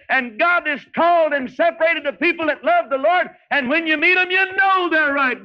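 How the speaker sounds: high, ringing preacher's cry; full-force revival preaching; fiery preaching. Acted. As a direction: preach it out to the back row. Preaching peak, high and loud.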